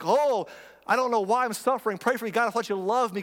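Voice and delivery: high, loud mock whine; whiny sufferer. Diabetic sufferer whines to God, high mock complaint. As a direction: whine like a self-pitying victim.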